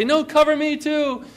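put-on high eager child's plea; a child begging to be included; cover me shout. Child John asking to be covered.

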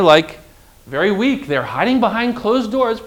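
usual speaking voice

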